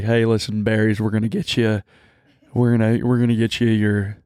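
lightly put-on deep Southern rich-guy drawl; a hint of a slow, easy Southern country-star drawl. Imitating the country singer who kept calling her [name], in a light Southern drawl. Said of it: "he talks like this".

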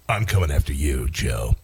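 deep, slowed menacing villain voice; mock threat. Host jokingly threatens Joe Rogan in a deep villain voice. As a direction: growl it low like a movie villain.

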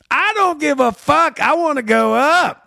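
hammed-up defiant comic demanding the stage; imitated drunk comic insisting loudly; mock insistent shout.

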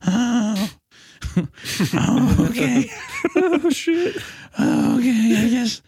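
put-on high giggly defeated kid; high laughing Buster. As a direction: cave in with a nervous laugh.

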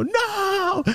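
long, very high cry of dismay; hammed-up high, soft howl; mock anguished wail. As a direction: wail it like a melodramatic film realization. Outside, cries out at realization.

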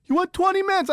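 high-pitched, exasperated booker; lightly acted outraged telling-off; mock angry scolding.

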